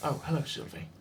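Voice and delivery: put-on weary old undead voice, lightly done; a tired ancient skull, half-voiced; ancient lich voice.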